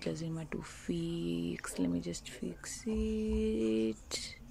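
usual speaking voice

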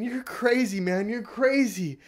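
put-on high, loud yelling at the old man; retold scream. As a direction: scream it back at him from the shore.

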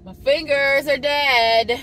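high, drawn-out wail; loud complaint. Real, not acted. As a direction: wail it loudly in protest at the cold. Complains about freezing cold outside.

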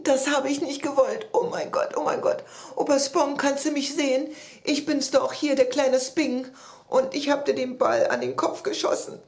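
high-pitched distraught pleading; tearful confession. A narrator's reading. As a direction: confess while acting close to tears. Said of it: "dabei musste er weinen". (weinerlich und verzweifelt gestehen)

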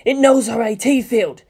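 scream. A narrator's reading. Shinji screaming to the Evangelion, panicked cry. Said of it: "Shinji screamed to the Evangelion".

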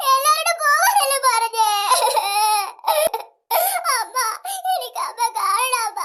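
slow tearful wail; sobbing for mother. Acted. Frightened girl sobbing, calling her mother. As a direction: cry slowly and call for mum.

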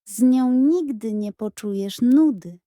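ordinary delivery

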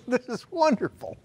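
high, laughing joy; preacher laughing with his congregation; laughing delight. Real, not acted. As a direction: chuckle it out delighted.

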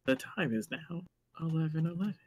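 distant, breathy announcement; hushed eerie game announcer; dreamy distant voice. Retold or imitated. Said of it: "that, like, voice echoes in my head".

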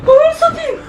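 high shriek, laughing and crying; shocked shriek. Real, not acted. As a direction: shriek out in disbelief. Shocked at the scene.

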